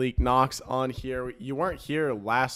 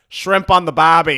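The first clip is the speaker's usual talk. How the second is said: loud, higher mock Australian accent; 4 semitones higher, 14 dB louder than usual. Host mimics people asked for the accent, mock Australian accent. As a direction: blurt it like a bad Australian impressionist. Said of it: "give me an Australian accent".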